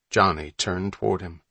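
ordinary delivery

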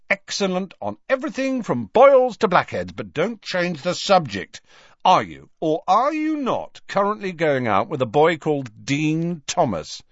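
high sales patter turning to grilling; brother Fred, interrogating. A narrator's reading. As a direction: press the sister for an answer.

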